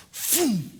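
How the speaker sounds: high falling "phoom"; imitated pitch whoosh. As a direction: whoosh like a fastball going by.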